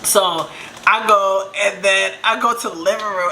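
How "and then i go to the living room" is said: normal talking voice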